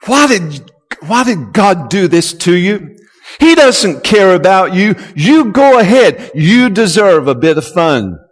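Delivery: voice of the flesh. Acted. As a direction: goad them to give in.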